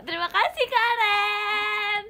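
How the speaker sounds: high, loud drawn-out thank-you shout; teriakan terima kasih. Real, not acted. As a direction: yell the gift thanks, stretching the name. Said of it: "ntar aku teriakin". (teriak nyaring berterima kasih)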